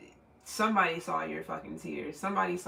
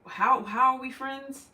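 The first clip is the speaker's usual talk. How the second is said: incredulous; 7 semitones higher, 6 dB louder, slower than usual. Retold or imitated. Incredulous imagined quote to friend.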